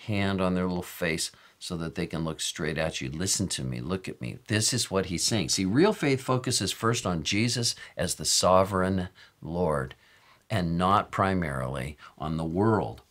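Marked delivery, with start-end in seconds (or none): none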